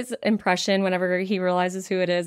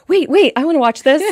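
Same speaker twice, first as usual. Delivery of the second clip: excited; 5 semitones higher, 6 dB louder than usual. Retold or imitated. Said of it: "and goes".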